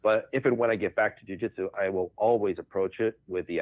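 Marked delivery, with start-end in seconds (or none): none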